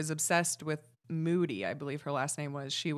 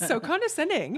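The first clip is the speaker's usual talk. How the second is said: laughing; 10 semitones higher, 4 dB louder than usual. Real, not acted. Laughing at the article's tone.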